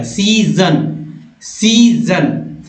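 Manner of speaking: सीजन said slowly, each sound drawn out with a clear z; slow model pronunciation. Shown as a demonstration. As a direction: spell out सीजन slowly like a teacher. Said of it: "तो क्या सीजन". (सीजन धीरे-धीरे, हर ध्वनि साफ़)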